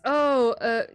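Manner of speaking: usual speaking voice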